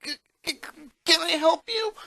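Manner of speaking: high, stuttering scared question; boy facing bigger kids, voice catching; frightened stammer. Acted. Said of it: "He gulped audibly".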